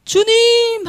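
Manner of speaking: high, urgent cry; calling out. Retold or imitated. The woman calling out to the Lord, urgent and high. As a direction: call out to the Lord. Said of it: "하고 찾았어요".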